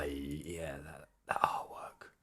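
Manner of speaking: whispered in awe; awed whisper. Real, not acted. Streamer awed by the ending artwork, soft whisper. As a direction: breathe it out in awe.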